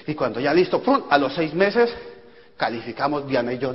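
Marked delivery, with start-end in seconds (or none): none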